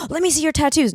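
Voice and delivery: a gasped, eager request; eager friends. Retold or imitated. Friends greeting her about tattoos, mock eager.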